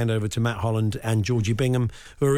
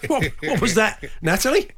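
laughing, higher, mock-incredulous; half-laughing what was that; amused disbelief; 7 semitones higher than usual. Real, not acted. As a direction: laugh out a stunned question. Reacting to swear in clip.

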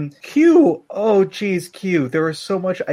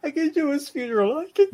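breaking voice; 11 semitones higher than usual. Acted. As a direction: voice cracks as you insist. Lemon insisting through grief.

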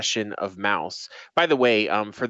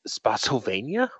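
ordinary delivery

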